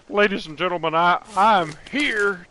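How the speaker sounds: lightly voiced outlaw leader addressing a crowd; failed first try at Dutch; Dutch impression. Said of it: "I'm trying to do a Dutch impression".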